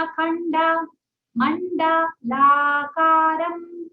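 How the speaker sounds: अखंड as akhanda then मंडलाकारम drawn out; teacher's correct model; full vowels, slow. Shown as a demonstration. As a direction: chant अखंड मंडलाकारम slowly with the a. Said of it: "इस प्रकार".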